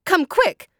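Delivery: very high, urgent call for help; high-pitched summons. A narrator's reading. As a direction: call a neighbor over in alarm. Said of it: "she called out".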